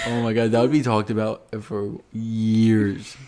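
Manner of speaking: ordinary delivery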